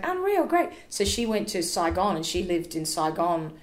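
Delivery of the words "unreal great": much higher, delighted yes; excited acceptance. Retold or imitated. Mum accepting dad's phone proposal, high and delighted. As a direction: jump at the proposal with glee. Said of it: "Mum was like".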